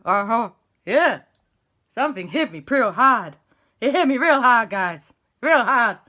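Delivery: loud dazed complaint; groggy El Fuego. Acted. El Fuego telling the crowd how it hurt, loud dazed. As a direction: moan about the hit.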